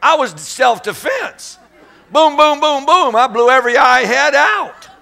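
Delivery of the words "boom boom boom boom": loud, high mock gunshots; shotgun booms. Boy blasting the owl, loud mock gunshots. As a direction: fire them off in self-defense.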